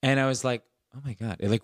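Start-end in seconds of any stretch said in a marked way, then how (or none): 0.9-1.4 hushed awe. Retold or imitated. Moved by mom's apology.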